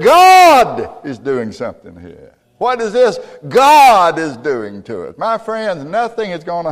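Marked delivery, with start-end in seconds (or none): 0.0-5.2 loud, slow, awed declaration; earnest exclamation. Retold or imitated. Brothers realising God is at work, loud and awed. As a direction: proclaim it with awe. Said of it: "No, they said".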